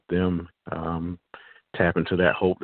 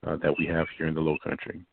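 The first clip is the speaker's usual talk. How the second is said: ordinary delivery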